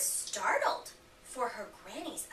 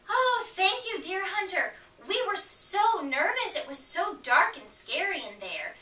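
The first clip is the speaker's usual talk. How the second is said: much higher and louder, gushing relief; relieved thanks; 8 semitones higher, 5 dB louder than usual. Acted. Red freed from the wolf's belly thanking the hunter, excited.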